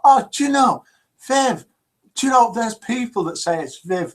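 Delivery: mock gushing. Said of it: "says Viv".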